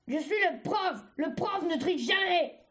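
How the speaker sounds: forceful, affronted self-justification; indignant retort. A narrator's reading. Cercerelle answering Argile's accusation of cheating, indignant retort. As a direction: assert authority over a complaining pupil. Trainer rebuking pupil who accuses her.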